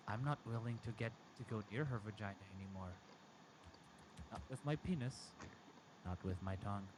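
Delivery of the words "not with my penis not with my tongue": ordinary delivery, nothing marked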